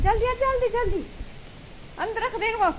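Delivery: high-pitched urging call; urgent hurrying. Real, not acted. Hurrying someone to put things inside, high urgent call.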